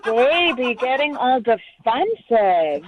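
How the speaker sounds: drawn-out, taunting fake sympathy; slow, condescending put-down; mocking pity. Real, not acted. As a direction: coo it slowly to needle him. Taunting him in a dating quarrel.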